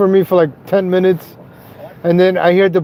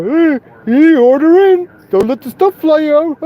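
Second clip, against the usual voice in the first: high, goofy mock of the mayor's advice; a high, doofy parody of de Blasio; 9 semitones higher, 3 dB louder than usual. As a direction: sneer his words back in a much higher, dopey voice. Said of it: "de Blasio sounds like that idiot I used to make fun of".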